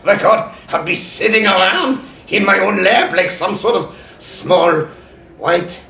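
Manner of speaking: high, loud mad scientist voice with cackles; loud, high evil genius, laughing between lines; Doctor Wily. Acted. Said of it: "can I talk to Doctor Wily?".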